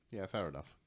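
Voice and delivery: ordinary delivery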